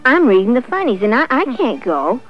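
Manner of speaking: busy refusal. A narrator's reading. Sassy the cat turns down Little Red Hen, busily.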